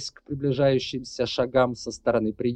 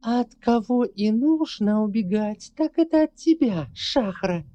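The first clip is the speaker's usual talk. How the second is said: hissing; 12 semitones higher, 4 dB louder than usual. Acted. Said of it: "внезапно прошипела".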